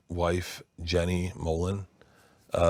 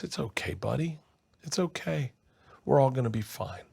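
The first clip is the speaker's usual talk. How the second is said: soft, higher-pitched soothing; tender reassurance; 4 semitones higher, 5 dB quieter than usual. Retold or imitated. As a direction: gently comfort a crying baby. Father figure comforting the baby.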